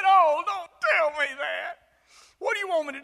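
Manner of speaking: loud, high pleading cry; anguished protest. Retold or imitated. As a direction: beg the Lord not to ask it, loudly.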